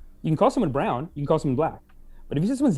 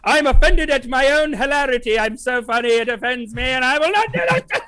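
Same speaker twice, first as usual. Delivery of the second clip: high, rising theatrical outrage; mock offended; 6 semitones higher than usual. Co-host offended at their own hilarity, mock outraged. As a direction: climb higher into a hammed-up squeal, ending in laughter. Hams up being offended by himself.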